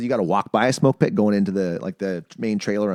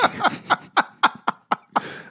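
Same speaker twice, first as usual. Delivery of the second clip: high breathy laugh. Real, not acted. Laughing at coworker's remark.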